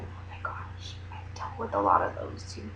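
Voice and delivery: hushed aside, breathy and low; whisper. Real, not acted. Confiding to viewers about jealous girls, whispered. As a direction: drop to a secret whisper.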